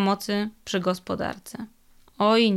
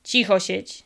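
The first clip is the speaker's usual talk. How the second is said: menacing warning. A narrator's reading. Sabała warning the trapped devil, menacing. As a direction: shut him up with a threat. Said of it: "spojrzał Sabała groźnie".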